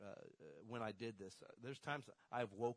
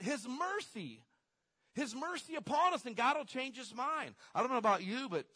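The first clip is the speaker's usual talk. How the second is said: preaching at full force; 8 semitones higher, 9 dB louder than usual. Acted. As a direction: build it up to a ringing peak.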